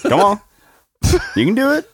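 high, coaxing pep talk; teasing encouragement. Real, not acted. Urging co-host to read the name, teasing encouragement. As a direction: egg them on, pitch lifted high.

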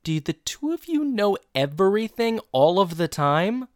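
hammed-up exasperated question; exasperated kid. Retold kid exasperated at all-knowing parents.

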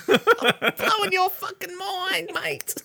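high, slow laughing taunt; gleeful slow mockery; laughing tease. Real, not acted. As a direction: stretch it out while laughing at him. Teasing friend, laughing.

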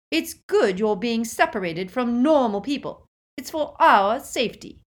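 loud, gloating taunt; with relish. A narrator's reading. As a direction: taunt your sister, high and loud, relishing it. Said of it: "said Petunia with relish".

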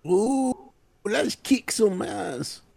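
high, drawn-out playful whoop; hammed-up battle cry. Reacting to Cobra Kai's return, hammed-up battle cry. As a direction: stretch it out with mock relish.

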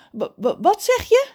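stuttered start leaping to a high question; stammering. A narrator's reading. Mother reacts to her son's plan, stammering in shock. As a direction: stumble on the first word, then burst out. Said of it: "stamelt zijn moeder".

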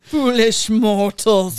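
slow, loud spooky host voice; slow theatrical villain menace. Acted. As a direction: draw it out like a haunted house host. Haunted Mansion ghost host line.

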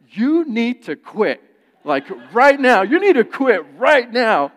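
loud, slow, furious order; loud retold angry demand. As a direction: lay down the law to your wife. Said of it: "I was so mad".

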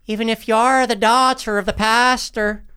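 mock old English accent, pitched high; bad period accent. Co-host mimicking the main girl for his friend, bad period accent. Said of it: "her accent is so bad".

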